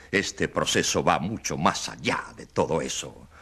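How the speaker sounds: normal talking voice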